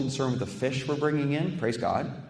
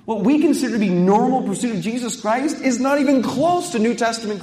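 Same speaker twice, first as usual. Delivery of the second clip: higher, louder, urgent preaching; fired-up preaching; 9 semitones higher, 7 dB louder than usual. Acted. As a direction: preach it all-in, full force. Preacher builds to full force.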